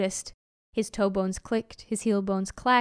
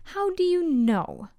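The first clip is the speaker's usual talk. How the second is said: higher-pitched pointed question, falling at the end; teacher's challenge; 6 semitones higher than usual. A narrator's reading. Teacher questions the accusing crowd, pointed challenge.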